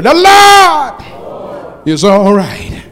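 drawn out, loud and high, every word stretched; slow shouted call. Shown as a demonstration. Preacher leading the congregation in unison, slow rousing shout. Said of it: "say it with me".

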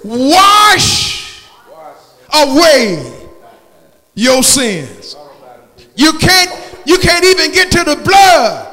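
rising, shouted gospel preaching; fiery preaching. Acted. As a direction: preach it out loud to the church. Preacher at full force.

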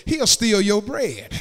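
normal talking voice

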